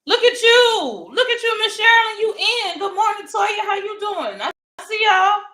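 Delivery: high and loud, thrilled to see them; squealing hello to the live chat; excited high greeting. Real, not acted. Excited greeting of arriving viewers.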